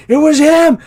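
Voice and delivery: loud, high-pitched mock outcry; hammed-up high yelp of blame; mock accusation. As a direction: cry out an exaggerated accusation. Retold joke accusation in hospital bed.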